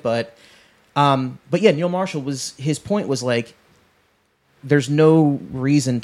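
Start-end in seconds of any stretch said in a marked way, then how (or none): none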